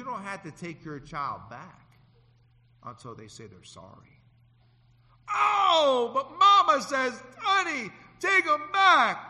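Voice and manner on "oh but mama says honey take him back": high, loud, slow wail; pleading mama. Retold or imitated. Mother pleading to take the son back, high crying cry.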